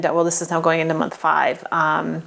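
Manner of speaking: usual speaking voice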